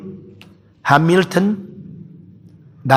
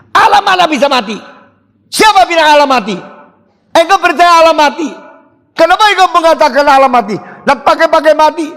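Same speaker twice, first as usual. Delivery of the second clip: indignant shout; 10 semitones higher, 4 dB louder, faster than usual. Retold or imitated. Luther rebukes wife's claim.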